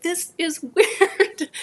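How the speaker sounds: high, drawn-out, laughing disbelief; amused disbelief. Retold or imitated. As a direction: stretch it out, breaking into a laugh. Reacts to finding an unseen helper.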